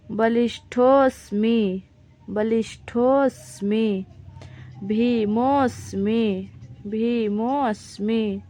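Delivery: each Sanskrit word said slowly twice, syllable by syllable; careful recitation. Shown as a demonstration. Teacher models pronunciation for the class, slow and careful. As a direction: recite every word slow and clear, as for students to repeat. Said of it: "बस इसका इस प्रकार से उच्चारण किया जाता है".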